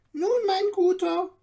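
lively little dwarf. A narrator's reading. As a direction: chatter on like an excitable dwarf. Dwarf continues the tour.

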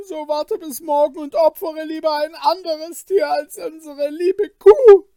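high, loud, anguished begging; desperate pleading. Acted. Kaspar begs Wilm to spare the cow, desperate high plea. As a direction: plead at full voice in despair. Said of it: "mit der Stimme der Verzweiflung".

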